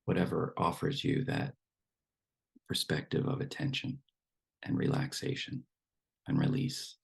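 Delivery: unhurried meditation guidance with long silences; guided meditation. Acted. Teacher settles the group into relaxed breathing, guided meditation voice. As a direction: ease them into stillness, phrase by phrase.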